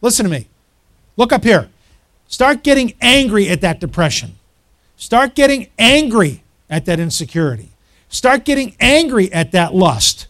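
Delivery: loud, hammering exhortation, punching each repeat; full-force preaching. Real, not acted. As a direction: drive the congregation on, building in force.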